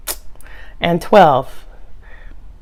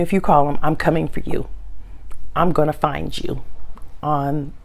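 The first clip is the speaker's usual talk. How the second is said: quiet mock threat; 4 semitones lower, 7 dB quieter than usual. Real, not acted. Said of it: "It's our secret".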